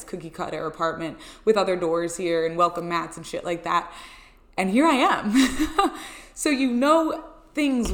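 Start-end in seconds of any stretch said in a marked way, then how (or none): 4.6-7.9 laughing. Real, not acted. Delighted about new apartment.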